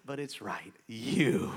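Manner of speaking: ordinary talk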